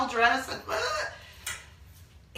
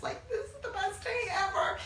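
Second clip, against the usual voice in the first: laughing tearful joy; 12 semitones higher, 5 dB quieter than usual. Real, not acted. Overwhelmed with happiness at screening.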